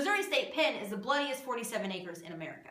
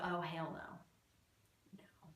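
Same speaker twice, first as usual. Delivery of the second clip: hushed scared whisper; 7 semitones lower, 13 dB quieter, much slower than usual. Retold or imitated. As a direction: breathe it out, scared. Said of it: "I was like".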